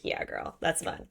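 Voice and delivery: soft, whispery cheering-on; hushed girl-to-girl approval; conspiratorial aside. Retold or imitated. Reader's private cheering aside.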